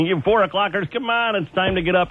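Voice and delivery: ordinary talk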